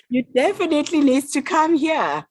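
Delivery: amused, still giggling as she insists; laughing. Real, not acted. Guest laughing as she urges the host to visit. Laughing at tourism brochure joke.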